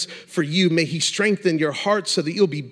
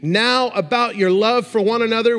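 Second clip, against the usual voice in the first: preaching; 5 semitones higher, slower than usual. Acted. Preaching scripture with rising force.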